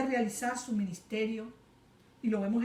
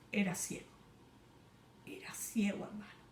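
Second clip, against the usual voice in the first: softer whispered repeat; hushed preaching; 8 dB quieter than usual. Acted. Preacher whispering to the brother about blindness. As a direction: let it sink in, hushed.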